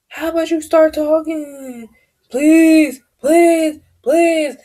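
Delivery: high, loud mocking plea of please; high-pitched mock pleading girl voice. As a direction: imitate a pushy girl begging him to talk. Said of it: "the other girl was just like".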